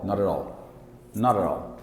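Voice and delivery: low, soft American "Not at all" as nada-rall; quick American flaps in "Not at all"; American accent. Shown as a demonstration. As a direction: run "Not at all" into one American word. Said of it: "Esse T vai ser R".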